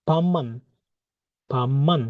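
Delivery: ভাম্মান with a doubled m, bam-man; Korean word pronounced. Shown as a demonstration. Teacher models the word for students. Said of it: "বিয়োগের উচ্চারণ মিয়মের মতো হয়ে যাবে।".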